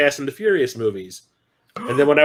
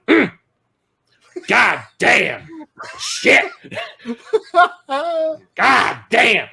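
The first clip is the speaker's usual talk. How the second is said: imitating lusty mom; 5 semitones higher, slower than usual. Mom ogling the actor in the theater, hammed-up swoon.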